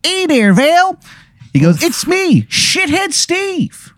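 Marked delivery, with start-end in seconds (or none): 0.0-1.0 high, nasal-free Baltimore drawl at full tilt; bad Maryland accent. Game master voicing a Baltimore informant, thick Maryland accent. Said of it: "has the worst Maryland accent". 1.8-3.9 put-on much higher, slow, softer Maryland accent; bad Maryland accent. Informant introduces himself to the player in Maryland accent. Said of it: "the worst Maryland accent".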